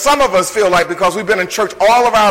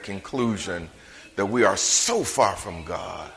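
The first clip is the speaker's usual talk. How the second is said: soft, low, confiding sermon voice; hushed pastoral appeal; intimate preaching; 11 semitones lower, 9 dB quieter than usual. Acted. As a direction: lean in and speak gently to the congregation.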